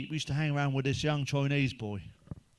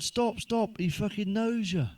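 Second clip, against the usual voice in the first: mock shout; 7 semitones higher, slower than usual. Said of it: "the other one shouted".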